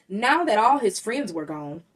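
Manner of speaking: ordinary delivery, nothing marked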